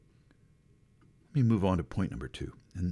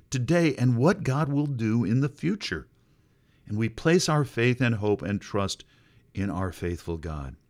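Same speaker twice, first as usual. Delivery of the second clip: ordinary talk, nothing marked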